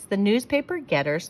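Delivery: plain reading voice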